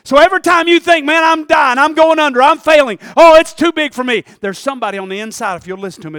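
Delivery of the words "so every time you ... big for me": loud pulpit preaching; rising sermon. Acted. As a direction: lift the crowd with each line. Preaching climb, louder.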